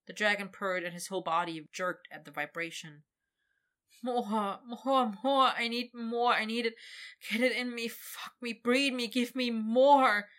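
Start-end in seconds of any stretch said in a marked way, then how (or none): 4.0-10.3 higher, rising desperate begging. Acted. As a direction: plead more and more urgently.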